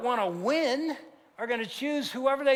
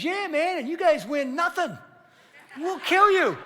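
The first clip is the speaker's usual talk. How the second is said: high, louder mock trash talk; cocky taunt; 8 semitones higher, 4 dB louder than usual. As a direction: imitate a cocky kid, building to a loud threat. Retold cocky kid taunting pros.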